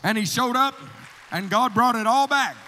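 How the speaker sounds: loud, triumphant preacher's cry; full-force preaching. Acted. Preacher shouting to the church that God brought it all back. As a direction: preach it out, ringing with victory.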